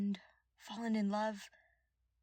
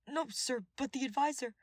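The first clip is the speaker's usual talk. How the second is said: nervous guard; 7 semitones higher, 4 dB louder than usual. Acted.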